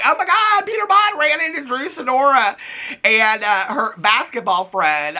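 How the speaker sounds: high, squealing mock fangirl; excited fan gush. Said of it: "I thought people were gonna be like".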